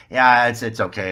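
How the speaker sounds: loud, hurried-sounding reassurance; flustered brush-off. Retold or imitated. Man caught banging his head tells a stranger, flustered. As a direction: brush it off too loudly, flustered.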